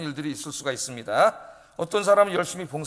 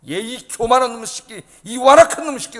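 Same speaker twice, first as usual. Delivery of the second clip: mock cursing; 4 semitones higher, 7 dB louder than usual. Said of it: "그러는 거죠".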